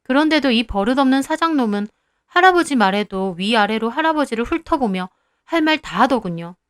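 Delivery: ordinary delivery, nothing marked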